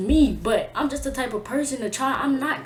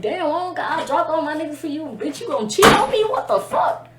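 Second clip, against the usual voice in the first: higher-pitched, wounded protest; hurt outburst; 6 semitones higher, 3 dB louder than usual. Retold or imitated. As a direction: build to an incredulous accusation. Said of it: "I be feeling lonely".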